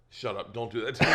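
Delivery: weak, breathless after laughing; barely recovering from a laughing fit; after laughing hard. Real, not acted. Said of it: "Your face dropped".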